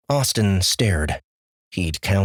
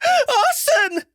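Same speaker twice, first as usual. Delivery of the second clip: very high screeching cry. Acted. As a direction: shriek the name in panic. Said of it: "his mother screeched".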